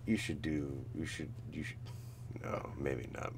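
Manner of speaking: ordinary talk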